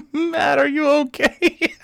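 put-on high giggly check-in on a crying kid; laughing concern. Re-enacting asking hurt Matt, laughing through it. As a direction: try to sound worried, keep laughing. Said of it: "And I'm like".

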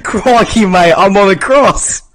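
loud, high mock Australian yelp; exaggerated Australian accent, shouted; Australian Jesus. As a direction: cry out in hammed-up Australian. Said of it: "Imagine Australian Jesus".

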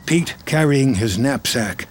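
normal talking voice

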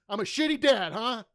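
loud, high indignant mock shout; imitating yelling dad. Retelling dad yelling on his birthday, indignant shout. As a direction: yell it like an offended father. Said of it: "when he yelled at me".

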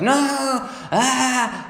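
high mock toddler wail; screaming toddler. Babysitter imitates the toddler refusing, mock scream. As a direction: wail like a three-year-old. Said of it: "he was like".

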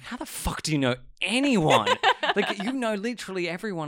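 loud, high, disbelieving; incredulous. Retold or imitated. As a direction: ask it in disbelief. Quoting baffled colleagues.

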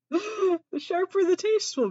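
giggling, squeaky repeat; laughing. Real, not acted. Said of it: "repeated after laugh".

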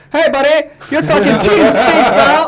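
high, loud mock Canadian accent, laughing at the end; a Canadian accent, pitched high and loud; Canadian heckler accent. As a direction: ham up a Canadian fan jeering. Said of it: "Could you imagine being up in Canada?".

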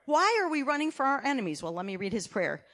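ordinary talk

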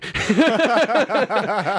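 loud high laugh breaking out; big burst of laughter. Real, not acted. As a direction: laugh hard, high-pitched. Laughing hard at the story.

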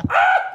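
sudden high, loud yell; imitated yell. Kid barging into mom's ASMR recording, imitated piercing yell. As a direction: mimic a kid yelling into the mic. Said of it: "just yelled into the microphone".

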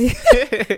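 loud burst of laughter; real, big laugh. Real, not acted. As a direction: crack up loudly. Cracking up over the microphone.

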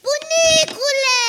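high, slow, stretched call. Acted. Competing to call grandfather. (strigare înaltă și lungă)

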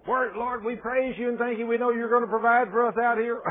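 high, loud mock praise, the faith they lacked; playing the thankful people, hammed up; mock grateful Israelites.

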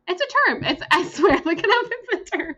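high, laughing insistence; laughing protest. Real, not acted. Defending her phrase to a friend, laughing high. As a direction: insist through giggles it's real.

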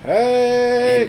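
excited shout. Real, not acted. Hyped shout at joke.

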